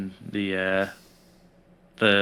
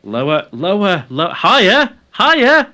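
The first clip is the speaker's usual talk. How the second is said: teasing; 9 semitones higher, 8 dB louder, slower than usual. Acted. Game host teasing his friend about the answer, getting louder. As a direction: wind him up by switching answers.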